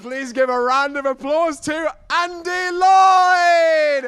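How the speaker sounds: slow, loud, drawn-out big intro; announcer shout. Real, not acted. Compere calling the audience to applaud the next comedian, slow loud shout. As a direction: call the act on like a boxing MC.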